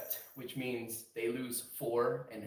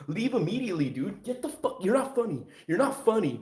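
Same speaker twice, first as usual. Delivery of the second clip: louder, fed-up telling off; mock angry; 6 semitones higher, 8 dB louder than usual. Streamer voices the room telling the troll to leave, loud. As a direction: kick the troll out, annoyed. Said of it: "And it's like".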